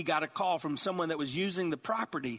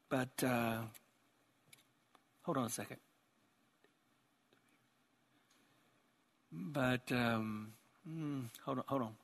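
much softer, halting disbelief; stunned hesitation; 5 semitones lower, 7 dB quieter than usual. Acted. King stunned by the fire, softly halting. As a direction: trail off, searching for words. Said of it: "was astonished".

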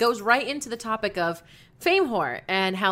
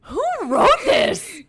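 high, slow disbelief; can't believe it's real; shocked; 12 semitones higher, 7 dB louder, slower than usual. Real, not acted. Shock at emotional letter.

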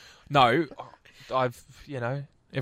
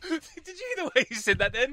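ordinary talk, nothing marked

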